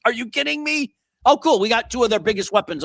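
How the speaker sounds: high-pitched incredulous outburst; exasperated sarcasm. Real, not acted. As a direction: squeal it in mock disbelief. Exasperated rant, sarcastic reaction.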